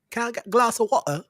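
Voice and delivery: high-pitched mock English accent, "water" losing its r. Teasing the English about "glass of water", mock English accent. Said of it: "not doing a very good English accent".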